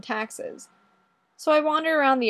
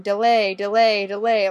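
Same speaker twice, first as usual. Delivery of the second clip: mechanical announcement; 5 dB louder than usual. Acted. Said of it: "it's flashing".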